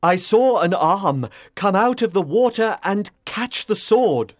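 a touch of breathless wonder; mildly excited, lively report; excited knight. Acted. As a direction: report the marvel to your king eagerly. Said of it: "ran excitedly back to the king".

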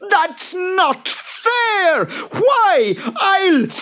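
very high, loud furious creature roar; furious high boggart scream. Acted. Boggart raging.

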